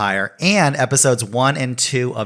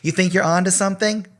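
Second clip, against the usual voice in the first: mocking taunt; 8 semitones higher than usual. Poisoner taunting players in game.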